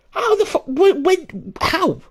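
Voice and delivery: high-pitched, stammering disbelief; retold bafflement. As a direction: stumble over the questions, incredulous.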